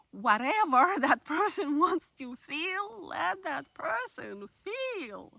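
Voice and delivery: slow, drawn-out mock Russian accent with a laugh; slow Russian accent, half laughing. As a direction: savour each word in a playful Russian accent. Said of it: "kind of like nice Russian, um, uh, accent".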